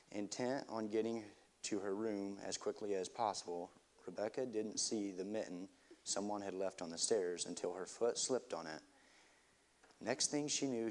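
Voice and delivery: soft, hushed storybook reading; quiet story narration. A narrator's reading. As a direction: read it gently to a listener. Reads the story aloud.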